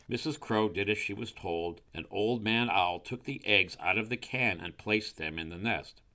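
ordinary delivery

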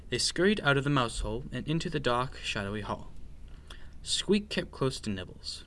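usual speaking voice

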